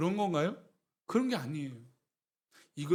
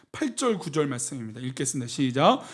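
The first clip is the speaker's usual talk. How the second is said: ordinary talk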